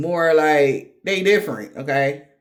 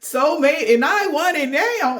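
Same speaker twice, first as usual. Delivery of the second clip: high, pleading mock voice, dragged slow; a lightly hammed-up impatient lover; whiny soulmate demand; 8 semitones higher, 3 dB louder, slower than usual. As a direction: imitate a needy lover demanding it now. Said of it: "He's like".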